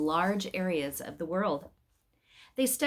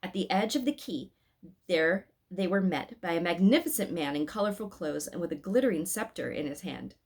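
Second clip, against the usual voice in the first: usual speaking voice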